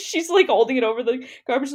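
ordinary delivery

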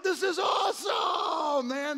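thrilled shout welcoming the new guy; excited shout. Retold or imitated. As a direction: boom it out like a thrilled youth pastor. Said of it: "And they're like".

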